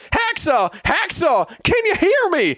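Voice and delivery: very high, hammed-up frantic shouting; frantic calls. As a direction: call to a wounded comrade in mock panic. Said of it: "came the frantic calls from Dexon".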